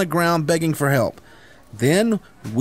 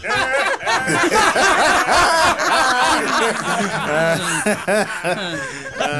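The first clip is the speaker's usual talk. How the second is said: drawn-out high laugh at himself; long self-mocking laugh; long wry laugh; 9 semitones higher than usual. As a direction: laugh it off with the gang. Friends laugh at his injury.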